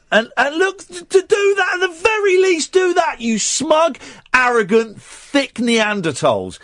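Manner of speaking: high-pitched ranting insults, slowing at the end; exasperated tirade. Real, not acted. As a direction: spit out each insult in high indignation. Insulting smug British tourists.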